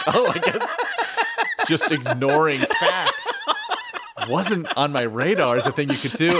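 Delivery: giggling at how absurd it is; laughing disbelief. Retold or imitated. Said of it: "and I was just like".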